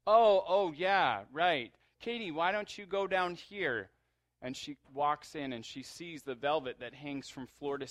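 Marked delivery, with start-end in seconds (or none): 0.1-3.9 high, loud, over-eager yeah yeah; nervous fake brightness; flustered cover-up. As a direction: gush to hide the lie. Angel keeps covering, steering Katie.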